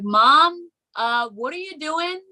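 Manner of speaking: baffled high voice calling up to mom; incredulous daughter. Retold or imitated. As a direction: call up to mom, confused. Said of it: "I was like".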